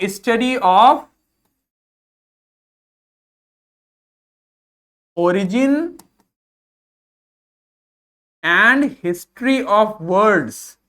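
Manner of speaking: very slow, spaced-out definition; dictating word by word; slow dictation. Shown as a demonstration. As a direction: read it slowly for notes. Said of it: "slow dictation".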